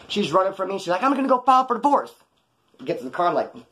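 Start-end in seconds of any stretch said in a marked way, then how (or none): 1.0-2.1 high, louder voice of the angry wife; mock wife yelling. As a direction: throw the threat back at him. Wife yelling while running off.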